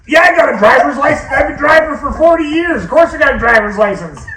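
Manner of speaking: put-on high, loud indignant old man; scoffing at a silly question; offended dad shout.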